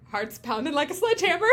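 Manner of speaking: high, loud comic exclamation; comical. Retold or imitated. Andy admitting his nerves to Prue, comical. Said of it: "it was so comical".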